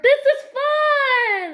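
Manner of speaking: very high, loud, slow squeal of joy; excited cheer. Acted. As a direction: cry it out with delight. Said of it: "they all said".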